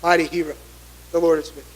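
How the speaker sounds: softly blessing. Acted.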